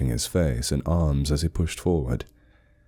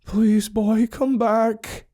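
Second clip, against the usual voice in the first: desperate plea; 4 dB louder, much slower than usual. Acted. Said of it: "his voice small and desperate".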